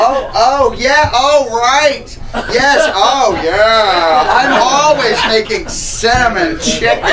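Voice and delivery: high, loud, over-eager agreement; gushing eagerly. Acted. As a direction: fawn over him loudly. Daughter gushes eagerly, loud, high.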